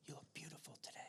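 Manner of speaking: soft whisper to the animal. Real, not acted. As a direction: whisper sweetly close up. Whispering to the groundhog.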